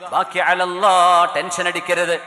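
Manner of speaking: ordinary talk, nothing marked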